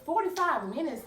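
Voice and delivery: shocked high-pitched question; can't believe the wait time; incredulous. Retold or imitated. Incredulous at 45-minute tow wait.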